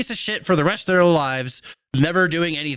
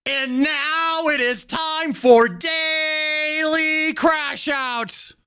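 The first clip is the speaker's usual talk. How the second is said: slow, high show intro; announcer voice; 12 semitones higher, much slower than usual. Acted. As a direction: drag out the segment title. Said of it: "segment announcement".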